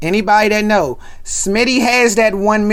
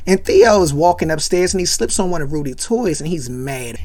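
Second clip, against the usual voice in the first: usual speaking voice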